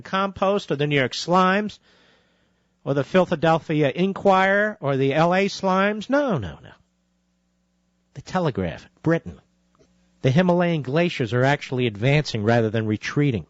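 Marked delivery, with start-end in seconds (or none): none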